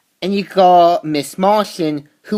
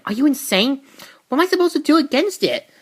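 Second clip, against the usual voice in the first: higher, fast mock panic; disbelieving panic; 8 semitones higher, faster than usual. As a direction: rush it out in alarm. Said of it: "Cassie reacting to chopper".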